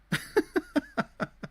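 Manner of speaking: breathy derisive laugh; scoffing laugh. Real, not acted. Mocking the dating argument she reads, scoffing laugh. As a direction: laugh it off in disbelief.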